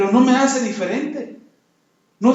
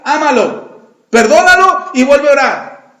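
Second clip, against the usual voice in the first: usual speaking voice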